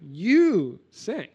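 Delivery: "You" pushed up high and loud, "say" tapering; emphatic you. Shown as a demonstration. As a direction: punch the word "You". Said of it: "here is also emphatic".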